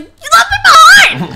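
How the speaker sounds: yelling at the cousin who ran, high and loud; mock shout. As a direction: yell after him as he runs. Cousin runs off, teller yells.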